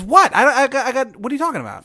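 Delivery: the Sugar King playing dumb to the angry player, high and shrill; fake-innocent Sugar King. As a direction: shriek out mock confusion.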